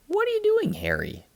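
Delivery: put-on high, eager kid voice; curious child. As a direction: call out like a curious little boy. Child greets friend.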